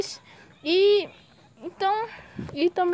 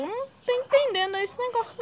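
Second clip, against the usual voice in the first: baffled; 11 semitones higher than usual. Real, not acted. Baffled by game physics.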